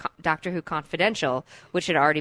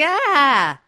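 "Ah!" as a higher, louder mock shriek of fright; mock cowering yelp; 9 semitones higher, 9 dB louder than usual. Cohost acting out cowering in the corner, mock scream. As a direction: yelp like someone ducking a spike. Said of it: "I'm the one cowering in the corner going".

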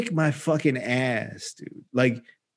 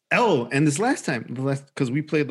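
usual speaking voice

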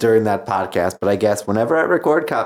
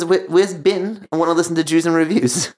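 put-on Australian accent; 6 semitones higher than usual.

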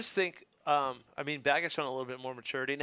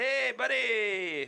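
goofy greeting voice; 7 semitones higher, much slower than usual. Pretend. Greeting a sponsor in a voice.

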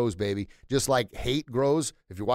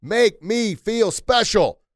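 much louder and higher, drawn out slowly; 10 semitones higher, 9 dB louder, much slower than usual. Shown as a demonstration. Preacher urging listeners to shout the sign, loud and slow. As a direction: belt the line out like a rally chant. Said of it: "Say it loud, say it proud".

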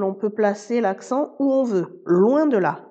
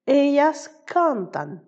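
"cantan" slow and higher, stressed CAN-tan; stress on next-to-last syllable; 5 semitones higher, slower than usual. Shown as a demonstration. Spanish teacher showing the rule to learners, CAN stressed in "cantan". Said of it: "l'accent tonique tombe sur l'avant-dernière syllabe".

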